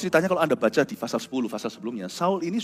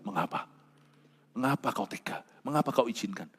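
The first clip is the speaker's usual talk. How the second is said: quiet whimper; 2 semitones lower, 8 dB quieter than usual. Retold or imitated. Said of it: "menangis karena bertanya".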